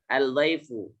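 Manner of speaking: "Al-dhaifu" with the l pronounced before the d; forbidden sounded lam. Shown as a demonstration. Teacher repeats the mistake for students, "Al-dhaifu" with l sounded. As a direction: sound out the l in "Al-dhaifu" without doubling the d. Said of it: "Tidak boleh ya".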